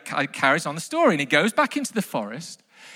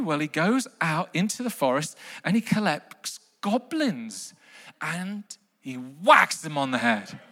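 usual speaking voice

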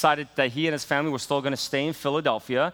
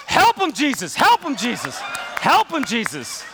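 high, loud repeated chant; congregation calling out; 10 semitones higher, 5 dB louder than usual. Retold or imitated. Congregation backing a struggling preacher with loud repeated calls. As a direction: call it out to back the preacher. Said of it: "They'll say".

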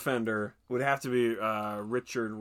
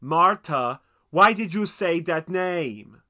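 put-on loud, drawn-out thick foreign accent; overacted outcry in an inscrutable accent, pitched up; Tommy Wiseau; 6 semitones higher, 10 dB louder, much slower than usual. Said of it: "you famously have Tommy Wiseau".

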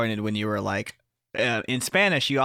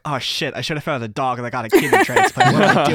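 rising panicked outburst, louder; 2 semitones higher, 8 dB louder than usual. Retold or imitated. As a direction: blurt it out in sudden panic. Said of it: "the panic thought in my head".

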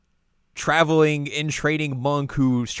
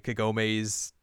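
normal talking voice

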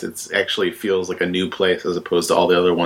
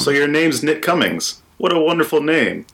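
higher-pitched game professor voice; Professor Dogwood; 6 semitones higher than usual. Pretend. Professor introduces grandson to the new player, high professor voice. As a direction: play the professor greeting a newcomer. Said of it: "keep talking like that".

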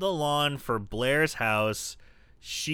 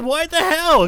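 much higher and louder, indignant; mock outrage; 11 dB louder than usual. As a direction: fling it out in disbelief. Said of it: "And she even says".

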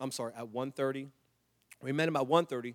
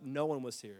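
ordinary delivery

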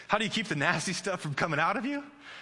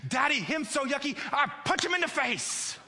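very high toddler imitation, escalating outrage; worked-up little girl; 12 semitones higher than usual. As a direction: squeak out a three-year-old's threat. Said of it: "the more worked up she".

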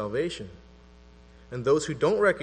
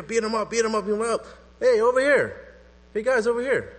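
higher and louder, egging the crowd on; mob calling out; 7 semitones higher, 5 dB louder than usual. Retold or imitated. Preacher voicing a mob urging an attack, calling out. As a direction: shout across to get the gang's attention.

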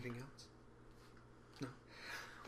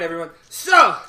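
ordinary talk, nothing marked

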